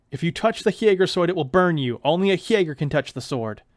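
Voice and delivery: loud, stern warning; villain warning. Acted. Villain warning Neil off the sword, loud and stern. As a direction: warn him off the sword.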